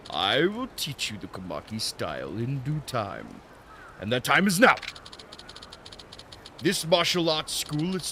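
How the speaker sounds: hammed-up, botched Japanese old master; Irish-Japanese Santa accent. Old master teaching his student, bad Japanese accent. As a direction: lecture theatrically in a muddled Japanese accent. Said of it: "Irish Japanese Santa".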